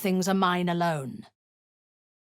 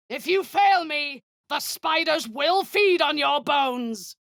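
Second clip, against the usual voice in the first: higher and louder, menacing; raised threat; 10 semitones higher, 4 dB louder than usual. Acted. Drow commander threatening a failing soldier, loud and high. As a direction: bark the threat at them.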